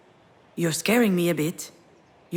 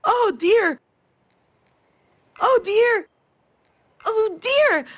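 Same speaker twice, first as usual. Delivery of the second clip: high-pitched mock scream; retold scream, shooting up high; imitated screaming; 12 semitones higher, 5 dB louder, slower than usual. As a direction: imitate a child screaming out, over and over.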